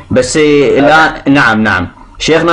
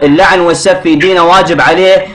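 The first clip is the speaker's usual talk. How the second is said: heated arguing; 6 semitones higher than usual. Real, not acted. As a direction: push your point over him. Caller argues heatedly with sheikh.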